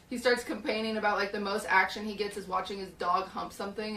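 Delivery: usual speaking voice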